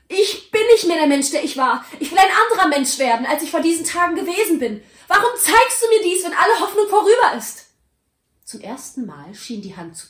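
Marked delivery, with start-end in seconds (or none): 0.1-7.7 high, loud, urgent pleading; desperate pleading. Acted. Scrooge pleading with the silent Ghost, loud and desperate. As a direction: beg for a second chance at full voice.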